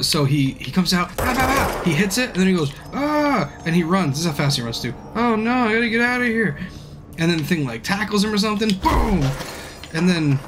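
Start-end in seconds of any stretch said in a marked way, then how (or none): 1.2-1.8 high-pitched rapid "pow" effects; mouth-made impact noises; imitated axe blows. 2.9-3.5 high-pitched imitated cry of alarm; hammed-up movie hero yelp; mock scream. Acting out hero's cry fleeing. 5.1-6.6 high-pitched hammed-up fleeing hero; mock panic. Acting out panicked hero running. 8.8-9.2 high-pitched "boom" sound effect; mouth-made crash of a tackle; imitated impact.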